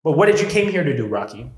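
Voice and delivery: high, loud, heated self-pep talk; fired-up rant. Real, not acted. Pumping himself up against a heckler, loud and heated. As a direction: rant yourself into courage. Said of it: "self-talk getting fired up".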